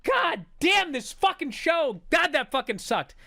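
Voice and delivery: loud, high retold exasperation; steaming mad. Viewer steaming after the match ends, loud angry cursing. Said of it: "So I'm fucking steaming".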